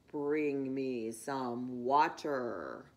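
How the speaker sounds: a low, slow voice of the field; deep slow field voice. A narrator's reading. Field answers the fox.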